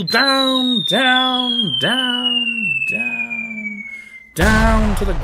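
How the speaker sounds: long high swooping words; drawn-out falling. A narrator's reading. Narrator follows the giant's long fall, drawn-out swoops. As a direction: stretch each down into a falling wail.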